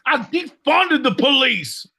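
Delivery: mock furious shout. Yelling at Sharon as police arrive, mock fury. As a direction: bellow it like a spoiled man-child.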